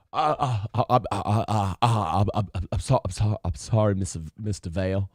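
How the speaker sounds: low, soft, meek stammer. Retold or imitated. Yeller caught out by his kids, meek low stammer. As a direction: stutter a timid apology, shrinking back. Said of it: "[name] would go from [name] to [name]".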